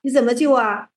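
normal talking voice

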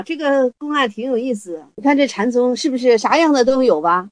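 normal talking voice

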